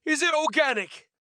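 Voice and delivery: high-pitched, horrified question; mildly hammed-up horror. A narrator's reading. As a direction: ask it high, as if appalled.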